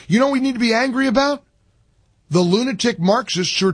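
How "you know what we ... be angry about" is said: higher and fast, voice climbing with indignation; heated outburst. Real, not acted. Radio host riling up his audience, rising fast and heated. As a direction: fire the question at the listeners. Host's rising rant on air.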